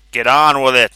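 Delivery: loud impatient shout. Acted. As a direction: bark the order at him. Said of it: "shouted Vanzetti".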